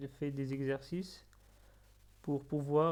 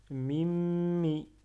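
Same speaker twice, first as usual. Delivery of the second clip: MIMMI with a long, loud held M; doubled M; 3 semitones higher, 7 dB louder than usual. Shown as a demonstration. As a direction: press and hold the middle M of "Mimmi". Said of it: "Je fais shadda sur le mim". (m du milieu appuyé et prolongé dans « Mimmi »)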